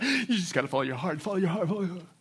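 mocking society. Preacher mimicking society's advice, softly mocking. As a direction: parrot society's slogan lightly. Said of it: "They talk".

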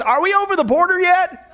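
a hint of muffled, high-pitched question. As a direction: imitate someone calling from a car trunk. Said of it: "in this muffled voice".

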